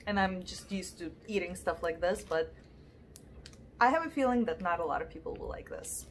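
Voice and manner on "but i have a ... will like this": ordinary talk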